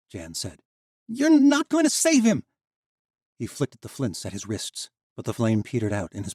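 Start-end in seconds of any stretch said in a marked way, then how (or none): 1.1-2.4 very high, loud defiant scream; a desperate boy threatening the rescuers; furious shout. Acted. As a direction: scream it at them in rage. Jan threatening over Darian.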